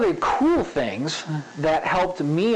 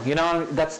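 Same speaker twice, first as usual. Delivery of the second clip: ordinary talk, nothing marked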